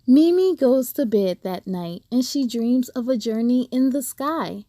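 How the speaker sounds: ordinary delivery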